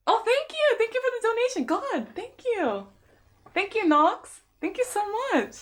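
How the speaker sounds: high-pitched, delighted thank-you; gushing thanks. Real, not acted. Streamer thanking a viewer for a donation, high and gushing. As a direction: squeal your gratitude in a high voice.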